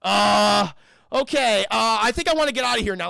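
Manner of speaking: hesitate, then hurry out the plea; imitated panic. Captain wanting out of the vents, mounting panic. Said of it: "Dallas is actually panicking".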